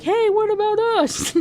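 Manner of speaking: high, loud mock indignation; indignant protest. Gargoyles to Merrick about being left out, indignant. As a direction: pipe up loudly, high and affronted.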